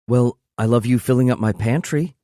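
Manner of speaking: cheeky woman's voice; smirking. Acted. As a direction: tease him with a smirk. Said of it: "She smirks".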